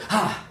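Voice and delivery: a sudden high startled "ha"; startled yelp. Retold or imitated. The startled author, a high yelp of surprise. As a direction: jolt like you've been spooked. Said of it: "and he goes".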